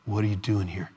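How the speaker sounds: soft, low, quiet question; still small voice. Acted. God questioning Elijah, quiet small voice. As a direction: ask it gently under the breath.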